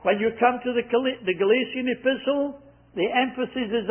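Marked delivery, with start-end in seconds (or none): none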